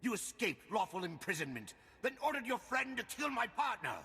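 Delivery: high, rising accusing voice; angry accusation. Acted. Enforcer accuses the fugitive of killing her partner. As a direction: accuse with mounting rage.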